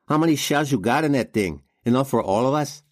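confident challenge, pitched well up; Spider, taunting gang leader. A narrator's reading. Spider taunts the outnumbered gunman, cocky and high.

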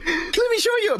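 high imitated shout; excited guy showing off. Co-host as the guy presenting the frog, high mock shout. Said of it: "the guy is like".

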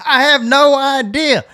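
high, drawn-out helpless reply; exasperated high cry. Retold or imitated. Rescuer answering his arriving friend, high and helpless.

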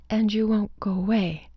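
plain reading voice

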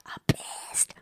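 breathy whispered "I'm pissed" under the breath. Pretend. Reacting to the angry hero, whispered.

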